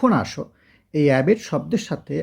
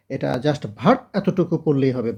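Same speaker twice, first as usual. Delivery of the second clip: ordinary delivery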